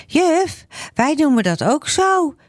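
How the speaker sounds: put-on high child's voice, calling out to the teacher; eager child. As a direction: pipe up like an eager little girl. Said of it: "zegt Jacqueline". (hoge, enthousiaste kinderstem)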